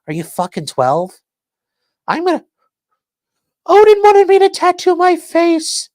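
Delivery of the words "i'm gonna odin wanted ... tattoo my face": high, loud put-on voice for the tattooed guy; mock tough guy. Streamer mocking the tattooed inmate, in a high loud put-on voice. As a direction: ham up the man's excuse for his face tattoo.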